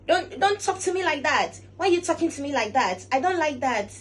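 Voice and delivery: high, loud indignant outburst; loud offended reply to someone who spoke rudely; brash angry retort. Shown as a demonstration. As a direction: bristle and push back hard. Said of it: "That makes you look very brash".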